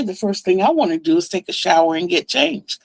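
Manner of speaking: plain reading voice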